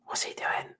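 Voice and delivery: hushed whisper to himself. Real, not acted. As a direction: whisper it, watching closely. Whispering while watching old footage.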